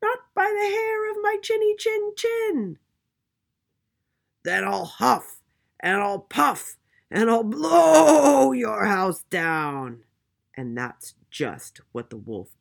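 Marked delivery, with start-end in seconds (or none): 0.0-2.8 very high, loud piglet voice; squealing little pig; little pig crying out. A narrator's reading. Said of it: "cried the little pig". 4.4-10.0 loud, higher wolf voice with a long-drawn swell; huffing, puffing storybook villain; big bad wolf threat. A narrator's reading.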